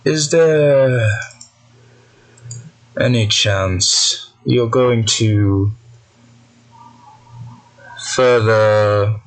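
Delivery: very slow, deliberate villain voice; long pauses between measured phrases; cold, precise menace. Acted. Mysterious voice probing Gira about blocked plans, coldly deliberate. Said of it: "Very cold, precise, almost stern".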